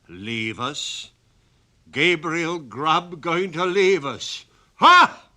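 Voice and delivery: loud, mocking goblin with a burst of laughter; laughing goblin. Goblin scoffing at the sexton's attempt to leave, laughing loud. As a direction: scoff then bark a laugh. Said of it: "As the goblin laughed".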